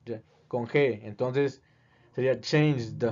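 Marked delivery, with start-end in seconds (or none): none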